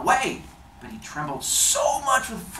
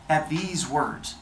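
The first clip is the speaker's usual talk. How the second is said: plain reading voice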